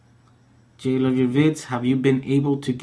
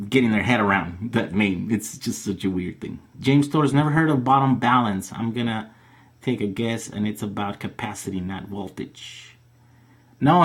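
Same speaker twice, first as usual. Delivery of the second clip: normal talking voice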